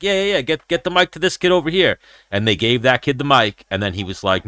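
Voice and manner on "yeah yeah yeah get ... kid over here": higher-pitched eager retelling of a request; retold calling out. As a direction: urge someone over, pitched up.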